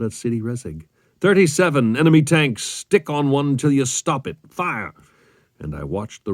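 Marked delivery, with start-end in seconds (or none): 1.2-4.9 urgent commander's order, high and loud; raised, commanding shout; fire order. A narrator's reading. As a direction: call out a battle order to the gunner. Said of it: "I gave my gunner the fire order".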